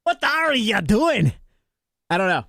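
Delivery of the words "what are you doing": slow, drawn-out mock Irish accent. Streamer showing how the phrase feels, mock Irish. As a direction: stretch it out in a put-on Irish brogue. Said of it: "accent you use to say that".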